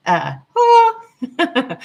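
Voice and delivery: excited yelp. Real, not acted. Sudden burst mid-lecture.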